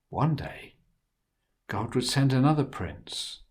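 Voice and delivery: ordinary delivery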